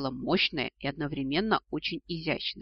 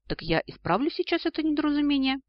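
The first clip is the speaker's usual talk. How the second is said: high, eager threat; toothy threat; 11 semitones higher, 4 dB louder than usual. A narrator's reading. Dragon threatens his unwanted daughter, menacing. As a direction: pitch it way up, teeth bared. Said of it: "оскалился дракон".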